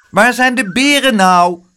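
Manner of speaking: put-on loud, high child's call; an impatient boy shouting. A narrator's reading. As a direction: call out eagerly like a small boy. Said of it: "riep Spijtebijt".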